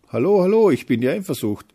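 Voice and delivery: high, loud, bursting hello; exuberant greeting. Acted. Jealousy drops in on the little feeling, exuberant greeting. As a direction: burst in loudly with a pushy introduction.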